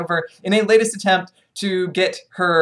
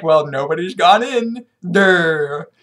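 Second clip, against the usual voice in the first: dim soldier; 2 semitones lower, slower than usual. Retold or imitated. Dim soldiers voiced mockingly.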